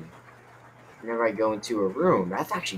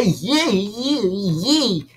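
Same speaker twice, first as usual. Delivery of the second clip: high, loud mocking squeak; squeaky mock imitation; annoying little voice; 8 semitones higher, 6 dB louder, much slower than usual. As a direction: mimic an annoying little character. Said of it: "That's what he sounds like".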